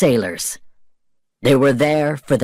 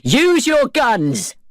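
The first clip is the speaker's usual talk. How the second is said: high, slow, drawn-out command; shouted order; 12 semitones higher, much slower than usual. Acted. Norrington urging his men to fire, slow shout. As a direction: stretch each word of the order out. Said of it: "shouted Norrington".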